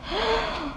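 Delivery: quick high intake of breath; shocked gasp. A narrator's reading. Kitten hearing of the intruder, shocked gasp. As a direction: gasp in mock horror.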